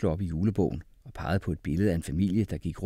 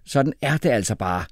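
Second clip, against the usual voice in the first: loud, raised insistence; heated, higher-pitched objection; shouted retort; 4 semitones higher, 7 dB louder than usual. A narrator's reading. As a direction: argue back forcefully. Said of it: "råbte Jeppe".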